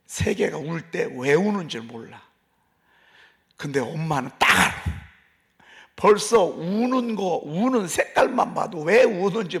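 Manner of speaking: ordinary talk, nothing marked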